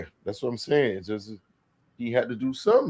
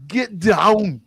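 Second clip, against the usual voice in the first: mock shout; 10 semitones higher, 5 dB louder than usual. Shouting movie line.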